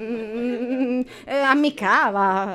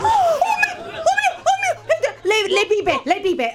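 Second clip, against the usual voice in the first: high, laughing oh my; scandalized giggling cry; laughing shock; slower than usual. Real, not acted.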